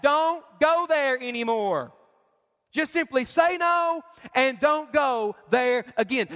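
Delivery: ordinary talk, nothing marked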